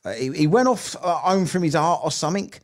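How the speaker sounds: normal talking voice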